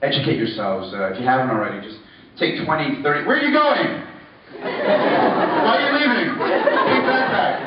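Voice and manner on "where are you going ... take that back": high-pitched, drawn-out mock plea; mock protest. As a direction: call after the departing audience in pretend dismay. Mock protest at people leaving.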